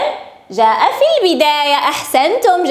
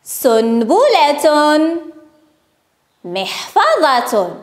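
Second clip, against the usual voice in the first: very slow, drawn-out vowels; slow word modelling; much slower than usual. Shown as a demonstration. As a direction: say each word slowly for young learners. Teacher models words for pupils.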